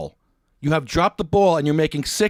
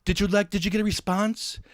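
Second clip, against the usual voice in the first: mocking high voice; 8 semitones higher than usual. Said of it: "I get a thing from you saying".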